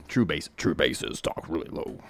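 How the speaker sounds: ordinary delivery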